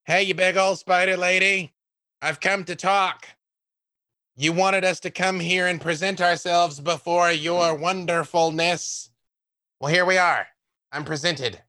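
booming voice. Acted. Adventurer calling out to the spider lady, booming. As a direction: project it boldly to the spider lady. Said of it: "make my voice boom".

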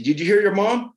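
loud, high, aggressive demand; angry stepdad yelling at the kid; aggressive retold. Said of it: "I was very, like, aggressive".

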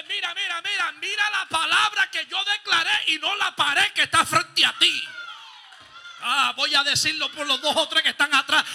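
booming preacher voice, very loud; preaching at full force. Acted. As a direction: thunder God's word at the congregation. Voicing God at full force.

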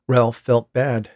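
ordinary delivery, nothing marked

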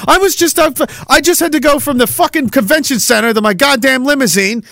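high, whiny mock rant of a frazzled politician; rattled politician. Politician begging Donnie for help, high and whiny.

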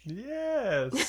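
high, laughing yes; giggling yes. Acted. Player answers in giggling voice.

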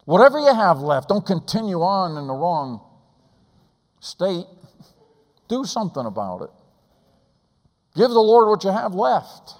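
high, loud pulpit appeal; fiery preaching. Acted. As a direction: plead with the congregation at full force. Preaching at full force.

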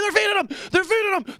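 high, loud retold scream of excitement; excited screaming. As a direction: shriek it like a kid at the aquarium. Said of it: "I'm screaming".

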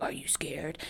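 low, very soft clown voice; creepy clown. Retold or imitated. Retelling the clown's first voice, low and creepy murmur. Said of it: "at first his voice was like".

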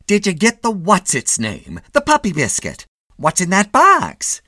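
high, loud interrogation; scolding wife. A narrator's reading. Wife checking on husband's errand, nagging.

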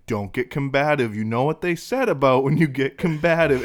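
hammed-up loud warning, playing nurse; mock stern caretaker. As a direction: son loudly scolding his mother in public, mock caretaker. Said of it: "everything's like".

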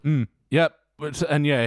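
usual speaking voice

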